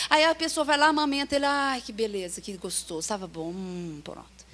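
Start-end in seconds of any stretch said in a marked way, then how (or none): 1.4-4.4 contented sigh. Retold or imitated. Satisfied baby after feeding.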